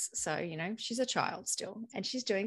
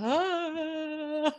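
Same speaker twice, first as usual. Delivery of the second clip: put-on higher exasperated child's groan; squirming cry; 9 semitones higher than usual. Daughter groaning under her mother's gaze, higher. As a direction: let out a cornered little groan. And she's like.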